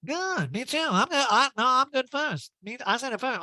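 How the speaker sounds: squeaky, eager, hammed-up voice; high mocking voice. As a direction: play it high and childish. Mocking eager agreement on the show.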